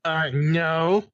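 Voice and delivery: high nerdy denial; nerd voice. Acted. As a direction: answer like a nerd. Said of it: "a nerd voice of some kind".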